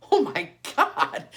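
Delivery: laughing through it, slowed down; nervous laugh while blurting. Retold or imitated. Laughing in panic at goose.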